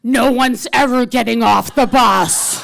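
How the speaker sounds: very loud, drawn-out roar; driver's furious roar. Retold or imitated. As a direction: explode at the passenger, stretching every word. Unhinged driver screams at her.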